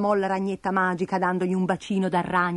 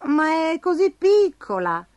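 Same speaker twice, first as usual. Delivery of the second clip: higher, slow puzzled remark; lightly voiced disappointed surprise; puzzled Bastoncello; 8 semitones higher, slower than usual. A narrator's reading. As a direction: draw it out, pitched up. Character puzzled by tiny gift.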